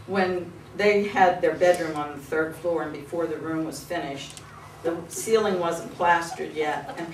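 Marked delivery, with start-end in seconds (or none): none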